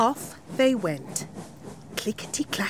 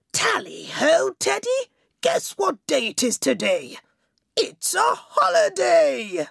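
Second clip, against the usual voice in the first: high, bright jolly king voice; a happy king bursting with holiday news; excited king; 6 semitones higher than usual. Acted. King Jolly calls out to his teddy, high and excited. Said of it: "said to his teddy".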